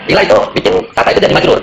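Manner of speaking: ordinary talk, nothing marked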